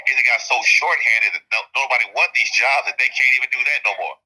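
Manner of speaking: ordinary delivery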